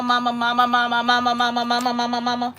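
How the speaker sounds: rapid, unbroken chant of repeated calls; relentless monotone kid chant; nagging kids imitation. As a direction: imitate kids calling over and over without a pause. Imitating kids endlessly calling mama.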